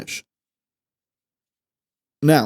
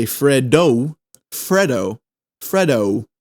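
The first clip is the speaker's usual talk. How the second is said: Freddo ending in an American oh-oo glide; American accent on Freddo; slower than usual. Shown as a demonstration. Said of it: "we can't be American here".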